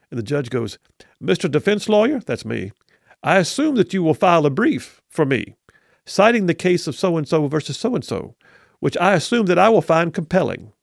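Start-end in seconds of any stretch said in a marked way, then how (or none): 1.2-2.2 higher Southern judge address; Southern accent. Retold or imitated. Attorney imitating old judge addressing him, Southern accent. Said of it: "you do a good Kay Ivey slash Southern accent". 3.2-10.7 put-on lightly Southern, knowing old judge; a dry old Southern judge hinting; Southern accent. Attorney imitating judge's hint about a brief, Southern accent. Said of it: "you do a good Kay Ivey slash Southern accent".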